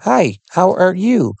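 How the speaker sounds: mock little kid voice. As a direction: sound like a small kid showing a toy. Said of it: "I sound like".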